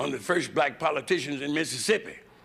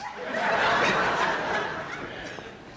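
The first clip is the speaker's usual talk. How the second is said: cackle. Real, not acted. Big cackle.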